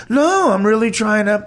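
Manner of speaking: a hint of a snooty rich friend brushing it off; arrogant rich guy. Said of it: "he has an arrogant rich guy way of talking".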